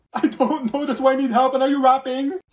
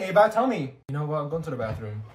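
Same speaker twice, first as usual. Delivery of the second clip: usual speaking voice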